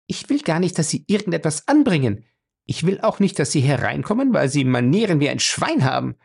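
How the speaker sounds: high, blunt woman's retort; frank. A narrator's reading. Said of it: "sagte Fay offen".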